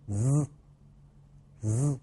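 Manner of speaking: "z" as a long buzzing voiced hiss; voiced z sound. Shown as a demonstration. Teacher models z for students to repeat, strongly voiced buzz. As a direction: hold "z" with strong vocal-cord vibration. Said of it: "发音的时候声带要强烈的震动".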